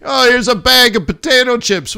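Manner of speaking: high, loud hostess offering a Coke; mock yell. Texan attendant tossing cans, imitated high and loud. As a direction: bellow the offer across the cabin. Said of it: "basically yelling at me".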